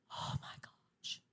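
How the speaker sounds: slow, very soft whisper; awed whisper. Retold or imitated. Girl returning after hearing the song, awed whisper. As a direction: imitate being stunned, barely voiced.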